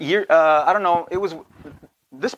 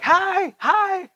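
high, overly bright greeting; mock over-friendly hello; forced cheery hi; 12 semitones higher than usual. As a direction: sing out a nervous too-cheerful hi. Forced greeting to old churchgoers.

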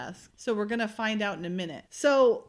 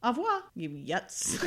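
imitated French sign-off, softer; French accent; 7 dB quieter than usual. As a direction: say goodbye as a mock Frenchwoman. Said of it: "So she's in a French accent".

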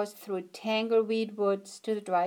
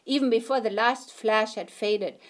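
ordinary delivery

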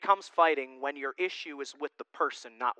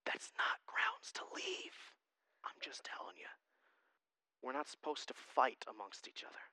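whispered aside; 5 semitones lower, 17 dB quieter than usual. Real, not acted. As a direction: lean in and whisper it confidentially. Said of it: "aside to congregation".

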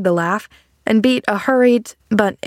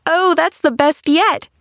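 put-on high, gleeful child's crow; crowing delight; 9 semitones higher than usual. A narrator's reading. Pollyanna delighted at the man's joke, crowing. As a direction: crow it with girlish glee. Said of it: "she crowed".

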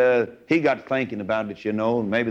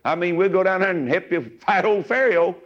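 put-on higher, eager little-boy voice; 7 semitones higher than usual. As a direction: pipe up like a small son offering Dad help. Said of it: "Little old boy said".

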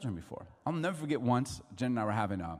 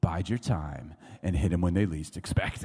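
low, laughing sly voice; scheming thought; 8 semitones lower than usual. Retold or imitated. Preacher voicing his own vengeful thought, low and sly. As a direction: plot revenge with a grin. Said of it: "my thought would be like".

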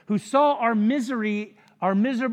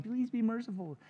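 soft, whiny retold plea; mock whining; 8 dB quieter than usual. Servant begging his creditor for mercy, imitated whining. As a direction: imitate a tearful beggar, quietly. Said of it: "Whining, crying".

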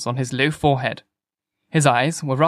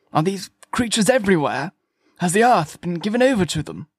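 higher-pitched anxious questions; distraught; 5 semitones higher than usual. A narrator's reading. Curate asks whether Martians rule the earth, distraught. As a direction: ask in mounting dismay.

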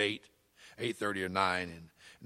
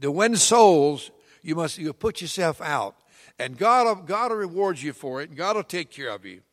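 preaching; 4 semitones higher, 6 dB louder than usual. Acted. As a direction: preach it to the congregation. Preacher addresses congregation.

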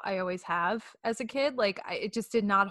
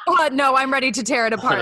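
laughing mock eager; 4 semitones higher, 9 dB louder than usual. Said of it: "A J's like".

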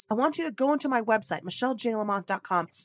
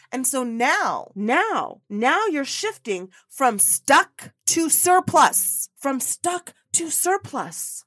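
building emphasis; 3 semitones higher, 6 dB louder, slower than usual. Real, not acted. Building climax of pep talk.

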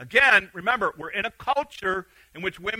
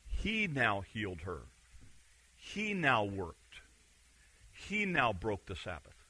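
each line dropped low, much softer and slow; slow emphatic hush; 11 semitones lower, 13 dB quieter, slower than usual. Shown as a demonstration. The preacher hammers home to the congregation, low and hushed. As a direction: lean in and land each phrase quietly on he. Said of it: "notice what the text says".

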